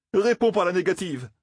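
voix nettement plus aiguë, implorante; supplie-le sur un ton haut perché; supplication. A narrator's reading. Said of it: "implora Matt". (ton implorant, voix haute)

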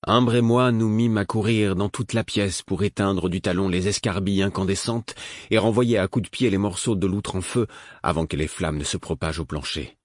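ordinary delivery, nothing marked

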